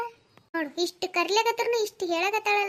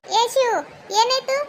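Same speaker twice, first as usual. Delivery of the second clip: higher, louder call to her son; mother calling her son mid quarrel; 7 semitones higher, 5 dB louder, slower than usual. Acted. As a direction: call him over in alarm.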